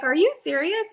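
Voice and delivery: can't believe what she just heard; stunned disbelief. Retold or imitated. Retold disbelief at winning news.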